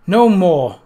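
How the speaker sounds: loud, high and forceful; cried out. A narrator's reading. Sabrina, gripping the dagger, crying out loud at Bernice. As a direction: shout it to cut her off. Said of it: "Sabrina cried".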